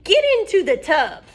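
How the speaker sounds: high, loud witch order; witch command. Acted. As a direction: bark it like a bossy witch. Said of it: "said Bony Legs".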